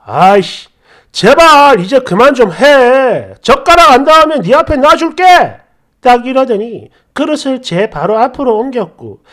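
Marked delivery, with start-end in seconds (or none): 0.1-5.2 louder retold burst of temper; husband's angry outburst. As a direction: replay the husband blowing up at his wife. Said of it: "갑자기 버럭 화를 내면서".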